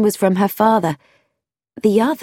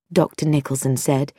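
usual speaking voice

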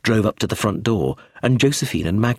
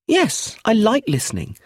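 put-on high-pitched little-girl voice; unashamed child; 12 semitones higher than usual. A narrator's reading. Child owns up to listening at doors, unashamed. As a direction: pipe it up high, owning up freely.

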